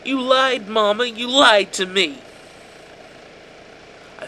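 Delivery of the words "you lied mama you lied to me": high, loud, accusing cry; raging son. A narrator's reading. The son turning on his mother in rage, high and loud. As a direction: hurl the accusation at her.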